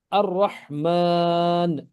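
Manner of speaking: Ar-Rahman very slow, ra doubled, ha joined; slow tajweed. Shown as a demonstration. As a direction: stretch Ar-Rahman slowly with a doubled ra. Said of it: "one Ra with the Hamzatul Wasl and another Ra".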